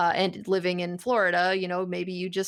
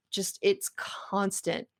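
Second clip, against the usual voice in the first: ordinary talk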